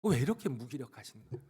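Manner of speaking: ordinary talk, nothing marked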